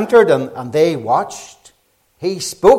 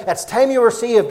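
raised preaching voice; urgent preaching; 6 semitones higher than usual. Shown as a demonstration. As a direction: drive home the urgency. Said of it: "could I emphasize the urgency tonight".

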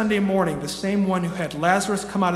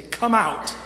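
higher-pitched call of command; 5 semitones higher, 3 dB louder than usual. Acted. Preacher voices the king at Lazarus' tomb, commanding call. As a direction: call it out as an order. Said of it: "walk up to Lazarus' tomb and say".

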